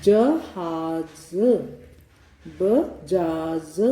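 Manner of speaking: जहाज said very slowly, first j plain, final z buzzing; जहाज में पहले ज फिर ज़, धीरे; j then z, very slow. Shown as a demonstration. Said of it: "इस तरह से उच्चारण होता है".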